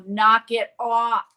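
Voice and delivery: loud, slow, stern warning; retold stern scold. Retelling Mitzi telling the ghost to stop, slow loud scold. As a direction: draw it out like a final warning.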